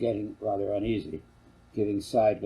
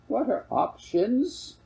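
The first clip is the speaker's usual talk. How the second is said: put-on much higher, slow childish question; higher little boy asking; child's voice; 12 semitones higher, much slower than usual. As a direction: ask slowly like a young boy. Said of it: "Brian asked".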